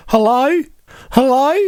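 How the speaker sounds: a hint of a puzzled dog, rising hello; lightly voiced confused dog. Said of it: "I'm like".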